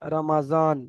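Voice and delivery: slow "রমাজান" with a buzzed Z; Urdu pronunciation. Shown as a demonstration. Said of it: "Urdu ভাইরা রয়েছেন". (উর্দু উচ্চারণে ধীরে "রমাজান")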